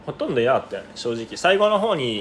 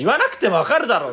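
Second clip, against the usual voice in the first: indignant mock retort; 8 semitones higher, 4 dB louder than usual. Acted retort to the MC.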